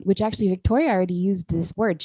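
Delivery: ordinary talk, nothing marked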